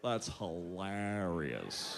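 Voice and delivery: very low, slow, soft drawl; listless low dude reaction; imitated dopey friend. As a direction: drag it out with zero energy. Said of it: "And he goes".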